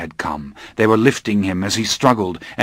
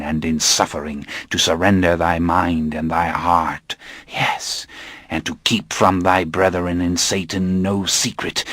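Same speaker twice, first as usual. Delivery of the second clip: sinister cult elder. Acted. Inquisitor recites the vow.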